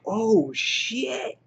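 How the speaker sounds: high, slow stunned gasp of disbelief; mock horror at a shock twist; reacting like a fan gutted by the death. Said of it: "and you're just like".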